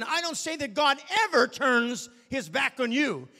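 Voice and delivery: high, loud, building sermon cry; impassioned preacher warning of sin; full-force preaching. Real, not acted. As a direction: preach it with fire.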